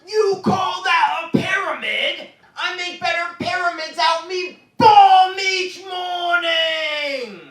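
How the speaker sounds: high, loud, slow shouting chef; Gordon Ramsay impression. Chef berating a cook over a pyramid, high shouted. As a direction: bellow the insult like a furious TV chef. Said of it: "Here's another impression".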